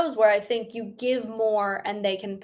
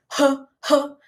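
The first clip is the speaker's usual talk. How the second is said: airy breaths; 4 semitones higher, 4 dB louder than usual. Shown as a demonstration. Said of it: "put a lot of air behind".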